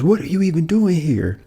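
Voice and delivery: high, indignant imitated question; mock challenge. Woman confronting the officers, imitated indignant challenge. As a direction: imitate her demanding an explanation.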